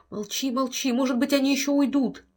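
high, pleading whisper-like urge; frightened pleading. Acted. Wife clinging to husband, high frightened plea. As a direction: beg him to be silent. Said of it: "умоляет жена".

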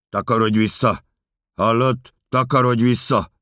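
loud, high commanding yell; official's shout. Acted. Official ordering the narrator back, forceful shout. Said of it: "A hatósági ember kiabál".

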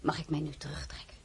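zacht, haast toonloos fluisteren; gefluisterd in zichzelf. Acted. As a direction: fluister het verlaten voor je uit. Alleen, verdrietig na de audiëntie. (alleen achtergebleven, zacht fluisterend van verdriet)

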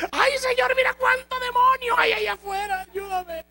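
high, loud desperate cry to the Lord; shouted prayer. Retold or imitated. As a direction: cry out to God for help at the top of the voice. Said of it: "Dice que le gritaba al Señor".

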